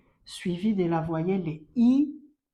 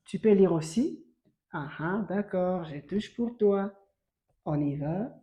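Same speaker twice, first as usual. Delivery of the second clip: ordinary talk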